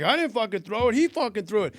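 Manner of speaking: high-pitched protest of innocence; retold angry denial. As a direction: mimic a man indignantly passing the blame. Retold audience member's angry denial.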